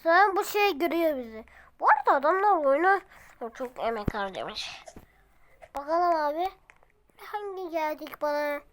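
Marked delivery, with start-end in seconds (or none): 3.5-4.8 whispered aside; whispering. Real, not acted. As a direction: drop to a whisper. (fısıldayarak)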